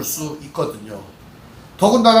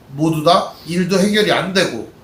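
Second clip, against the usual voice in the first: normal talking voice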